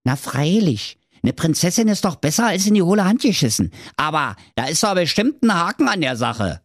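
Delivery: put-on brash and quick like a street kid; lightly voiced cheeky youth; cheeky lad Thorsten. Thorsten replies to the king.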